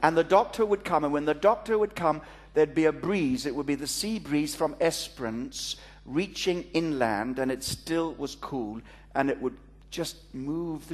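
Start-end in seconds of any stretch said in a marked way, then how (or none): none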